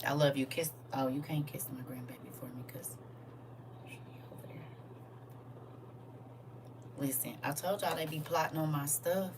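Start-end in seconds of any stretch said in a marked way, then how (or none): 0.9-4.6 soft secret whisper. Real, not acted. Whispering to the viewers because she's over there. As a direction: keep it hushed so she won't hear. Hushed aside, daughter nearby.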